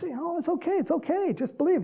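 high, soothing comfort; hammed-up gentle reassurance; mock reassuring. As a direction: soothe someone in a lifted, high voice. Jesus reassuring a troubled father.

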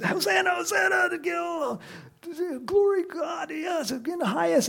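high-pitched jubilant crowd cries; wild crowd. Acted. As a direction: cheer like someone in a wild crowd. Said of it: "the crowd was going wild".

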